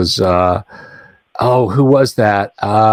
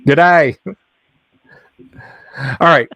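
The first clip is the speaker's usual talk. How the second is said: put-on Australian accent; 3 semitones higher than usual. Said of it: "This is the best I can do".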